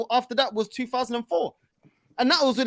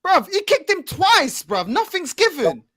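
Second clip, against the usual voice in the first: outraged protest; 7 semitones higher, slower than usual. Real, not acted. Outrage at referee.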